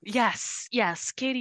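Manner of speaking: ordinary delivery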